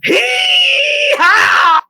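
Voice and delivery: very high, drawn-out hee-haw shout; shrieked, over-the-top character cry; cartoon catchphrase yell. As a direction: belt out the wild catchphrase.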